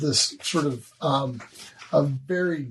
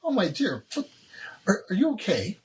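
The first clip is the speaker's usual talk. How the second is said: concerned Crystal; 7 semitones higher than usual. Acted. Crystal asks the crying prisoner if she is okay, pitched high. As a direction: rush to comfort her, pitched high. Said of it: "Crystal turns to you and says".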